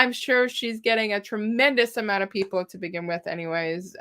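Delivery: normal talking voice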